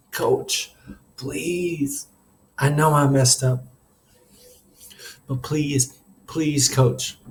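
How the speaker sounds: understated desperate begging; pleading, breaking up. A narrator's reading. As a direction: beg with words catching in the throat. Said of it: "The words began to break up in my throat".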